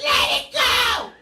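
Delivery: high yelled command; yelling. Retold or imitated. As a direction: yell it at him to let go. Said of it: "I just started yelling".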